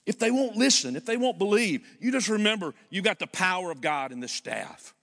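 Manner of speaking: loud, high, building insistence; rising preacher's fervor; preaching climax. Real, not acted. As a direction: drive it home to the congregation.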